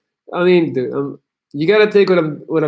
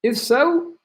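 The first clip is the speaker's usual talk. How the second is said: higher, rising challenge; fiery pep talk; 5 semitones higher than usual. Real, not acted. Streamer challenging a viewer to quit weed, fiery and high. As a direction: push him like a coach at a crossroads.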